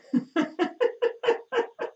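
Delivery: long squealing laugh; high-pitched laughter. Real, not acted. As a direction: laugh till eyes water. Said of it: "Y'all got my eyes watering now".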